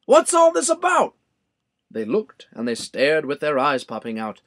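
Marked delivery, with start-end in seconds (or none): 0.0-1.1 much higher, louder crowd call; shouting crowd. A narrator's reading. Townspeople rushing in, shouting their question. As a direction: yell out in curiosity. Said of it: "the people came shouting".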